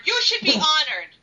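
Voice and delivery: mildly indignant mock yell. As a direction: raise your voice a little, as if telling him off. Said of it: "yell at Tony Bedard".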